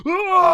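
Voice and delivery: high, loud roaring yell; play-acted enraged "Ah!"; mock battle scream. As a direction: scream out an attack, hammed up. Acts out Vegeta firing in frustration.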